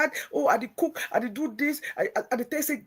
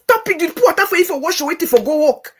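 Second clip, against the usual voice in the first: sharp, much louder and higher telling-off; retold scolding; 5 semitones higher, 5 dB louder than usual. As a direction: scold her hard, loud and high.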